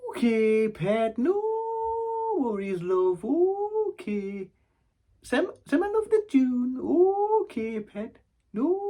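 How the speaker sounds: very high, sing-song nana voice; nana's phone goodbye. Acted. Nana saying a long goodbye on the phone, high sing-song. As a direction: stretch out the endless goodbyes in a high lilt. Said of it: "half an hour saying goodbye on the phone".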